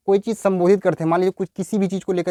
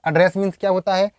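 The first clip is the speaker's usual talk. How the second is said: ordinary talk, nothing marked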